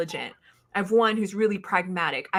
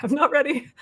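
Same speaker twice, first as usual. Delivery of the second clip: mock panic; 7 semitones higher than usual. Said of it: "I was like".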